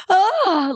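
high, theatrical gasp; mock pearl-clutching "oh"; hammed-up scandalized gasp; shocked gasp. Acted shock, clutches her pearls.

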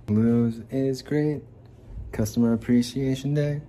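loud, slow, hyped slogan; hyped ad shout. Pretend. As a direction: sell it like a TV ad.

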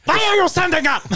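high, loud mock bark; mock guard shout. Pretend guard yelling at man standing to pee, high loud bark. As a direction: shout like a guard catching him.

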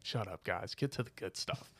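low, soft grumble of a bored listener; mock annoyed listener. Imitating listeners who aren't from here, grumbling. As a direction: grumble it like an impatient outsider. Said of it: "They're like".

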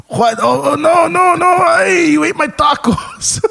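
high, loud wailing grandpa; hammed-up outraged grandpa, dragging out the end; grandpa impression. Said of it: "What did you think about that impression".

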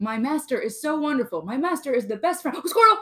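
adoring dog, then a sudden high, loud distracted yelp; Doug from Up. Host plays a dog praising its master, high-pitched and devoted. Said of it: "Doug from Up".

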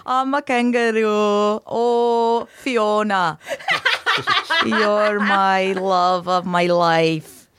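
slow, lovestruck mock Scottish kangaroo; drawn-out ogre's Scottish accent, played for laughs; Shrek.

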